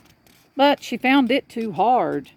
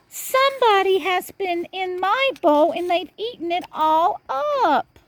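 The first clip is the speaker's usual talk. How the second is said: put-on much higher, tiny baby bear voice; wee little bear voice; 12 semitones higher than usual. A narrator's reading. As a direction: squeak it out like the littlest bear, upset. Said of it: "cried in his wee little voice".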